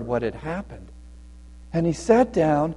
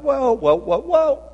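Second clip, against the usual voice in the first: higher-pitched halting whoas; guards halting someone; 8 semitones higher than usual. Acted. Guards stop the old man at the gate, halting him. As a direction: stop a visitor short.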